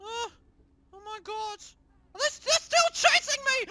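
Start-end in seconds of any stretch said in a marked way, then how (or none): 2.1-3.7 being chased. Real, not acted. Still chased by enemies.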